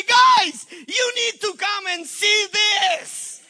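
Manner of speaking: loud, slow, high call to the angels; excited calling out. Retold or imitated. Jesus calling angels to see the offering, excited shout. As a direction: summon everyone, thrilled.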